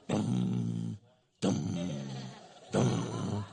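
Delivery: low, heavy mock doom steps; trudging sound effect. Husband on the longest walk to apologize, low trudging dums. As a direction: intone slow, low thuds with gaps.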